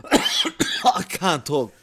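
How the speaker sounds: very slow, breathless from laughing; laughing hard. Real, not acted. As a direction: gasp it out after a fit of laughter. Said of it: "I'm crying".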